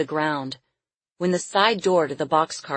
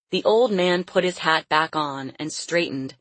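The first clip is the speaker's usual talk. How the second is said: ordinary delivery, nothing marked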